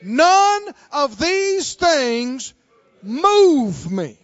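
very slow, loud declaration; faith talk. Acted. Congregation led in faith talk, slow and loud. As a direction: draw out each word with unshaken conviction. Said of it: "help me out".

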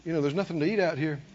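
soft, low, hurried murmur; disciples murmuring. Retold or imitated. Disciples quietly urging Jesus to send crowd away. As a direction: mutter the worry under your breath. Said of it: "Disciples say".

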